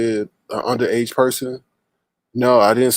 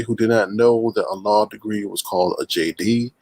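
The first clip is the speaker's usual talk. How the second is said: normal talking voice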